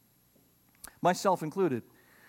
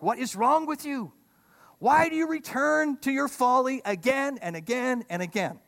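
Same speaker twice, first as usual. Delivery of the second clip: higher, pleading voice of God scolding fools; exasperated God; 7 semitones higher than usual. Retold or imitated. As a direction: play God, fed up and baffled. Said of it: "That's how God feels about fools".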